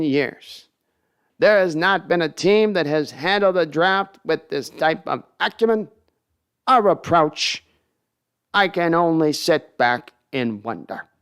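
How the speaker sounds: slow, pompous sportscaster with long pauses; ponderous vintage announcer voice; Howard Cosell impression. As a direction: drag out every grand word like an old broadcaster. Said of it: "We need some Cosell too".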